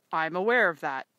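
firm father. Acted. Father firmly answers pleading daughter.

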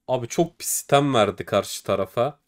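ordinary delivery

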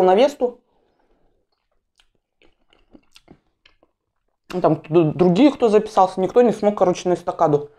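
eating with fingers while speaking; talking while chewing. Real, not acted. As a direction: mouth partly full of food. Seen 2: puts fish in mouth at 0.7-2.2, chews, then bites bread.

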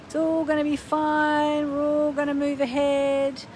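mock positive. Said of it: "being positive".

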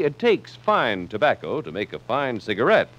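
ordinary delivery

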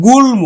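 গুল্ম with a clearly sounded m after l; correct pronunciation. Shown as a demonstration. Teacher giving students the real reading, গুল্ম with audible m. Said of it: "তাহলে ম ফলার উচ্চারণ হলো".

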